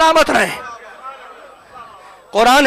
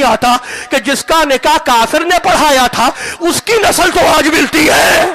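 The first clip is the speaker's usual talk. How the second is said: full-force preaching; 3 semitones higher than usual. Acted. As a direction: thunder the point at the crowd.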